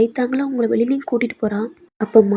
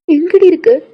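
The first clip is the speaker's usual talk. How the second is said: higher, louder urgent question; 5 semitones higher, 6 dB louder than usual. Acted. Friend urgently asks where she is. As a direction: demand it urgently.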